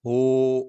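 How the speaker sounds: "हो" held out on a long, loud o; long vowel demo. Shown as a demonstration. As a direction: stretch the o in "हो" to show it is long. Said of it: "पहला वाला long sound देगा".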